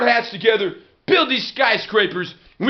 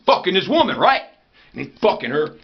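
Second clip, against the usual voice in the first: ordinary talk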